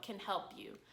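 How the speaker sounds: ordinary delivery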